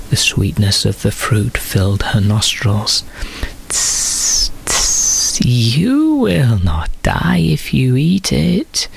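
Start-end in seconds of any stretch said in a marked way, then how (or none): 3.7-8.9 hissing, slow, breathy whisper; snake's whisper. A narrator's reading. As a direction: lean in and hiss the secret. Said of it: "he said".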